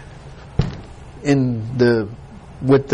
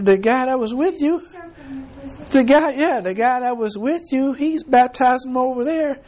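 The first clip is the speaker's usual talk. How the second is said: higher, hurried tattletale report; excited disciples; 7 semitones higher than usual. Acted. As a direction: blurt it out like eager gossiping followers. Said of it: "Do what I just said".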